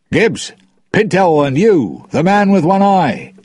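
loud, high-pitched call to the crew; cried out high and loud; crying out. A narrator's reading. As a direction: call out to your men across the beach. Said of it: "he cried".

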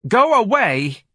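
very high, loud shrill shout; shouting lady. A narrator's reading. A lady shouts Ignatius away. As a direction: yell him away.

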